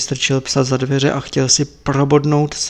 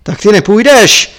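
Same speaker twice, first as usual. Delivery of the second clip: loud, higher furious roar; Lama roars; 6 semitones higher, 9 dB louder than usual. Acted. Lama roars at Tepaga to leave, furious. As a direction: bellow at the frozen monk. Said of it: "zařval Láma".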